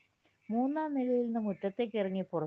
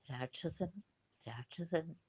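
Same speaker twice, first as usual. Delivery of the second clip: hushed, scared warning, much softer; frightened whisper; 9 dB quieter, slower than usual. Acted. Princess warning the hidden princes that the demon comes, hushed and afraid. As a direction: breathe the alarm under your breath.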